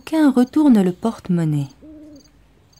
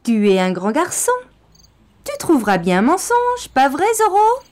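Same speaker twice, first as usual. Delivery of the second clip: rising higher, faster sneering taunt; mocking condescension; 10 semitones higher, 4 dB louder, faster than usual. Gang leader mocks Bastien: condescending sneer. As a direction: patronise the boy. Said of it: "Le rouquin a un petit rire".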